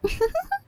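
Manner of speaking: soft, very high giggle; a quick high titter; amused giggle. A narrator's reading. Character giggles after exclaiming.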